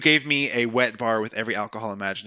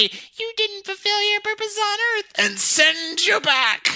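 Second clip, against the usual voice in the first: melodramatic high voice. Pretend. Dying melodrama continues.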